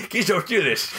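put-on a crusty Old West prospector; gruff mountain man. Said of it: "Batman's an old prospector from the West".

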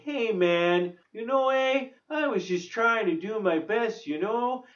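a hint of a chill yoga dude; yoga guy. Retelling the podcast bit, a mild yoga guy voice. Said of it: "yoga guy where he's like".

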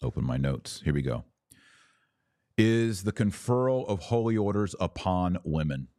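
ordinary delivery

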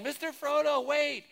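high, loud calling after a friend; retold desperate yell. Pastor voicing Sam calling after Frodo, high shout. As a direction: shout his name across the water. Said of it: "he's yelling".

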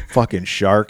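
ordinary talk

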